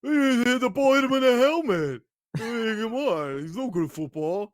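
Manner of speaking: hammed-up doubter voice; mocking a hater. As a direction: sneer it out like a know-nothing critic. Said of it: "that's how they talk".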